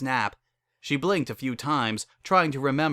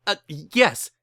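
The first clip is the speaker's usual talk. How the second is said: sputtering; 5 semitones higher, 4 dB louder than usual. A narrator's reading. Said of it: "he sputtered".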